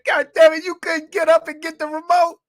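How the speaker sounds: high, loud, fed-up rant; a kid fed up at being called in for the remote; exasperated outburst. Acted. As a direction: explode at the absurd request. Exasperated at mom's request.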